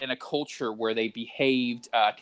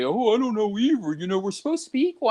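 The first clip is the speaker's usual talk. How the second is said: higher, quicker mock-older voice agreeing; a boomer nodding along, pitched up; boomer reply; 8 semitones higher than usual. Boomer agreeing about equality, higher mock voice. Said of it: "And then they'll say".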